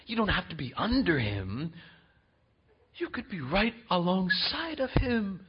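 hushed, tempting serpent voice; soft seductive serpent whisper; serpent's whisper. Acted. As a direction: coax like the serpent in the garden, barely voiced. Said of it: "The deception of the serpent was this".

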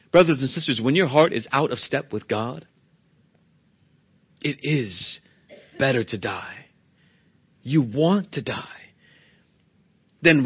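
normal talking voice

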